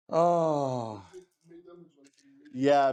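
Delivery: usual speaking voice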